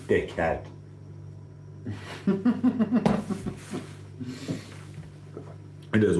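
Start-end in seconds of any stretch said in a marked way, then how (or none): 1.8-3.8 high, breathy cackle; real laugh. Real, not acted. Streamer laughing after insulting a commenter. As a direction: burst out laughing, then let it fade.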